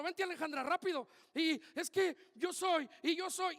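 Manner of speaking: loud, high urgent calls; calling people forward. Acted. Preacher calling the congregation to form a circle, loud and urgent. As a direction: hurry them into a circle, loudly.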